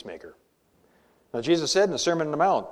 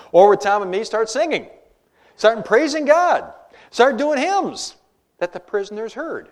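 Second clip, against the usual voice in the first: louder, higher and slowing on praise; rising fervor; 6 semitones higher, 9 dB louder than usual. Acted. Preacher stirring the congregation, rising fervor toward praising God. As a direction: swell up as if ready to sing.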